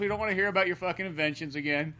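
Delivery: normal talking voice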